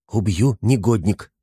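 usual speaking voice

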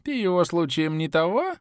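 put-on high, creaky old man voice. A narrator's reading. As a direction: croak it like an old geezer. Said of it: "скрипучий старческий голос".

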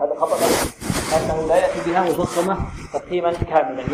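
normal talking voice

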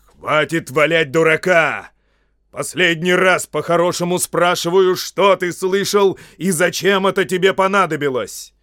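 thundering interrogation; angry ultimatum. Acted. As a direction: demand the truth for the last time. Angry wizard interrogates gardener.

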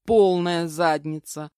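ordinary delivery, nothing marked

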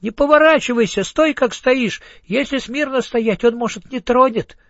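high, urgent tiny voices; excited ants. A narrator's reading. Left ants urging Egor not to turn, high and urgent. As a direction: plead with him to stand still. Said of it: "закричали левые мурашки".

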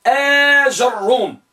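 آجروم slowly as aa-jar-rum; long آ, then open ja in آجروم; fifth vocalization. Shown as a demonstration. Teacher contrasting the fifth type, slow with open jim. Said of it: "لكن النوع الخ".

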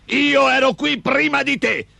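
high-pitched bawl of an angry big man; bawling ogre. A narrator's reading. Big man disputing the land with Gosto, bawling. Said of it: "berciò l'omone".

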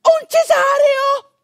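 high, slow, alarmed cry of disbelief; shocked patient hearing caesarean, frightened cry; panicked gasp. Retold or imitated. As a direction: gasp in horror at the word.

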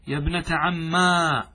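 slow demonstration of عما ending in a long aa; ya turned alif. Shown as a demonstration. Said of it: "أثبت الياء ثم قلبها ألفا".

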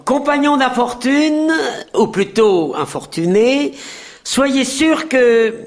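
a touch of grand oration; pompous speech. A narrator's reading. Chancellor addressing the crowd, pompous speech. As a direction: orate to the crowd. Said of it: "Vous aurez un discours".

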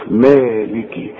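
مالكي slowly as "maa-li-ki"; voweled kaf. Shown as a demonstration. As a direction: sound the short "i" after k in مالكي. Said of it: "والكاف كتير قوي يغلطوا فيها". (مالكي ببطء بكسر الكاف)

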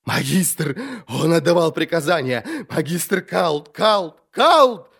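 frantic, climbing to a high scream; hysterical repetition. A narrator's reading. Prisoner repeating the name until silenced, hysterical. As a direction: build from high babble to a shriek.

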